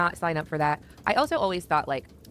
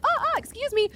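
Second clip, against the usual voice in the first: startled. Retold or imitated. To the stranger opening the car door, startled high squeak. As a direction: recoil in startled disbelief. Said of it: "And I'm like".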